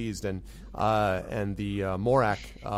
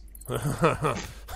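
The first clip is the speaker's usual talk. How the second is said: character's laugh; 2 semitones higher than usual. Acted. Game master as the goblin boss, laughing. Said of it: "he starts laughing".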